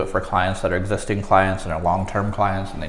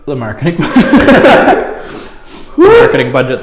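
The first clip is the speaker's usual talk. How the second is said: laughing through the heat; 6 semitones higher, 8 dB louder than usual. Real, not acted. Laughing mid-answer.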